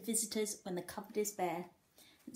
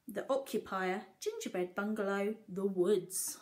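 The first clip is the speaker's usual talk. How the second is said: plain reading voice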